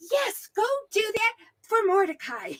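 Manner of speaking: hammed-up king giving the order; gleeful king. King orders Mordecai honored, storyteller amused.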